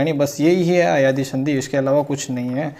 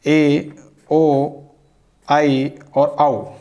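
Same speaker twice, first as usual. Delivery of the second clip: drawn-out vowel sounds for the class; sounding out the vowels; much slower than usual. Shown as a demonstration. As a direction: spell out each sound, one at a time. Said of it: "सूत्र इसका है".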